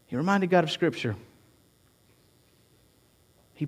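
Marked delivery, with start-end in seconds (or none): 0.1-1.2 much softer, reflective delivery; hushed repeat. Real, not acted. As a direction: let the point sink in quietly. Same point repeated hushed.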